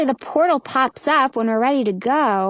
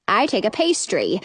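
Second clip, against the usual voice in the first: ordinary delivery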